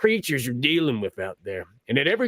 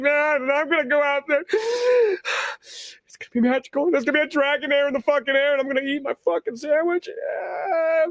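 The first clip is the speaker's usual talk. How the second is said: hammed-up high stoner bro voice; dreamy stoner bro; 10 semitones higher than usual. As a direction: float off into a stoned daydream about sunsets. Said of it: "who's like".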